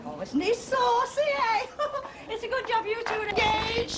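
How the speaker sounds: high laughing tease; giggling delight. Acted. Woman teasing the engaged couple, laughing delightedly. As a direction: laugh through the words, tickled.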